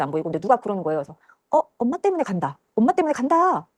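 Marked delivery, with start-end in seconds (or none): 1.5-3.7 high-pitched mimicked callers; raised pitch, ordinary volume; retold unseen voices. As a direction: imitate voices calling out from nearby. Unseen voices call out in dismay.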